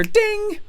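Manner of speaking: imitated ding. Phone notification.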